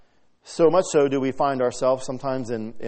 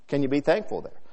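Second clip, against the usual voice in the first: normal talking voice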